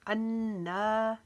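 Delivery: अन्ना with the final आ drawn long; stretched alif. Shown as a demonstration. Teacher demonstrates drawing out the alif of अन्ना. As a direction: hold the ending of अन्ना for one alif. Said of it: "एक अलिफ के जितना खींचा".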